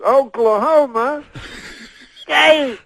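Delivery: high, loud, slow Cosby impression. Cosby teasing the stadium crowd, slow shouted impression. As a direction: stretch it out, pause, then belt the last word. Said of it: "he says it slower".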